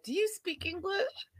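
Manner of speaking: high, soft, rising squeak of disbelief; mock incredulous question. Host quoting husband to his own wife, incredulous. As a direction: repeat it in hushed, high mock astonishment. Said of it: "He says to his own wife".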